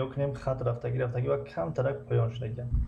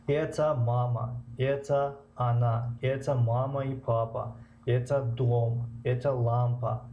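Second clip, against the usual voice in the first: slow model reading; slower than usual. Shown as a demonstration. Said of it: "Масалан".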